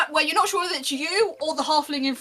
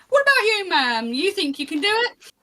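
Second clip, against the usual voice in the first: high, punchy challenge to a passer-by; pitched-up barker's call; 7 semitones higher, 3 dB louder than usual. Acted. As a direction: coax a newcomer into the contest. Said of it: "starts calling and going".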